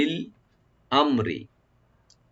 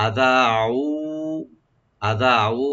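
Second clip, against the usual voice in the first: recited model; 2 semitones higher, 4 dB louder, much slower than usual. Shown as a demonstration. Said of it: "হামজাকে right দিবেন".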